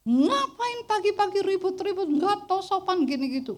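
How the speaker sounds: high, scolding neighbour yelling over the fence; angry neighbour. Retold or imitated. Neighbour's wife scolding from the gate, high and angry.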